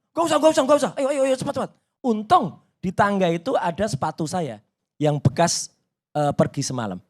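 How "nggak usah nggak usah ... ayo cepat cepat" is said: high, urgent repeated commands; mendesak. Retold or imitated. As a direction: imitate an officer hustling someone along. Petugas mendesak berangkat, dikutip. (meniru petugas mendesak buru-buru, nada tinggi)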